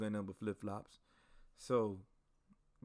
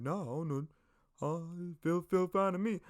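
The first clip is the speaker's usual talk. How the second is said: higher-pitched imitation of an oblivious dude; clueless comrade; 7 semitones higher than usual. Oblivious soldier insisting the wrong uniform fits, mock clueless. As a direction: put on a clueless guy's voice.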